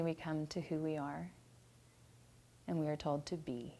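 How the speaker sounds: soft contemplative prayer voice, dying away to near silence; guided prayer. Acted. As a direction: lead them into stillness, letting each phrase sink in. Leads a centering prayer.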